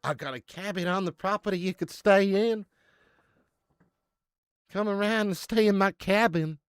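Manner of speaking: loud, high-pitched put-on stranger's voice; horror-movie cabin owner. Playing the stranger luring a guest to a cabin, loud hammed-up invitation. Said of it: "This is how horror movies start".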